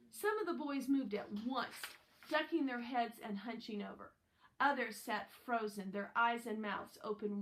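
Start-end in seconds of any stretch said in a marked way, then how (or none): none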